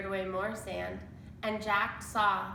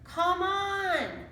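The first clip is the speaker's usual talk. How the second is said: much higher, louder call; shouting; 10 semitones higher, 5 dB louder than usual. A narrator's reading. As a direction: yell from the treehouse. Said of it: "Annie was shouting".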